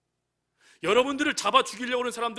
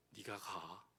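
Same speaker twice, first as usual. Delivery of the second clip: much lower and softer, hushed; a deep, hushed divine command; God's command; 13 semitones lower, 19 dB quieter, much slower than usual. Acted. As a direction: press it down low and quiet like God. God repeats command.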